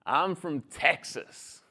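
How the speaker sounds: put-on slow, drawn-out Texan accent. Little boy answering his new Kiwi mate, slow Texan accent. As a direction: draw it out like a Texan kid. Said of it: "That's an interesting accent".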